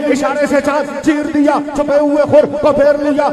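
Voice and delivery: high, driving rhythmic declamation; fervent verse recitation. Real, not acted. Preacher addressing praise verses to the Prophet, high insistent recitation. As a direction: hammer out short lines on a sustained high pitch.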